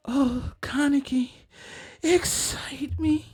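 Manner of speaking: very high, slow, whispered villainess; sultry maniacal voice. Shown as a demonstration. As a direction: purr it like a seductive madwoman. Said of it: "My sultry, maniacal voice".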